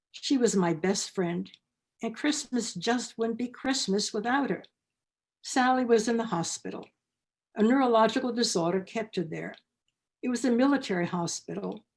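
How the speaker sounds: ordinary delivery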